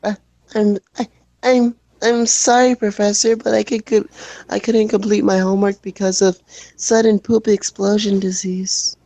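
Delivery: put-on high, stammering nervous kid; shy kid voice. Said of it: "shy kid voice".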